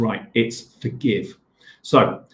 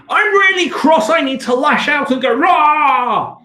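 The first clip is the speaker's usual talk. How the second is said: high, loud, slow shouted rage ending in a roar; teacher acting out an angry heart that wants to lash out; angry outburst; 13 semitones higher, 6 dB louder, slower than usual. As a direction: let the angry heart burst out with a rah. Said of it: "your heart is saying".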